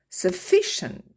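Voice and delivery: Sufficient with the stress on FI, high. Shown as a demonstration. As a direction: lift FI in Sufficient. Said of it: "l'accent tonique se trouve sur la syllabe d'avant". (accent tonique sur FI dans Sufficient)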